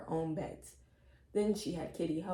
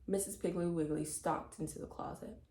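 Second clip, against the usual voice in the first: ordinary delivery, nothing marked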